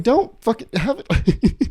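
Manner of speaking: high, slow, drawn-out disbelief; exasperated outburst. Retold or imitated. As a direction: stretch it out in incredulous outrage.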